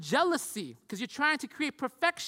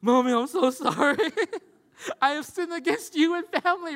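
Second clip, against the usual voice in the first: high, loud, melodramatic confession; hammed-up imitation of a kid pleading with Mommy; dramatic grovelling child; 7 semitones higher, 7 dB louder than usual. Son confessing his sins to Mommy, overly dramatic. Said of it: "it's this dramatic scene".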